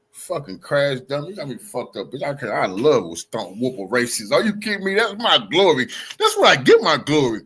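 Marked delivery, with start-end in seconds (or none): none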